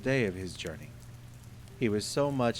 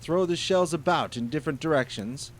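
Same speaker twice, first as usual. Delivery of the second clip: accusing genie; 7 semitones higher, 9 dB louder than usual. Acted. Genie interrogates.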